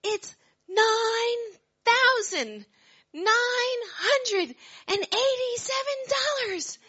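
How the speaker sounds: slow, very high, loud announcement; excited yell. Retold or imitated. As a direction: shout the number out, thrilled. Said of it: "you hear her yell".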